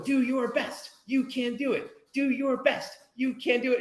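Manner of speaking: put-on high, bright kid voice cheering along. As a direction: cheer him on like an eager little kid. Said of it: "cheering me on".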